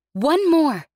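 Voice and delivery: loud, high shout over the noise. A narrator's reading. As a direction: yell it over a roaring engine. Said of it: "she shouted over the noise".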